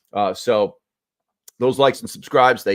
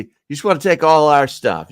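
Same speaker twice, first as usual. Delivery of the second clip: higher, louder complaint; mock indignation; 7 semitones higher, 6 dB louder than usual. Real, not acted. Host complains about treaty taking supplies, mock indignation. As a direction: protest it with rising indignation.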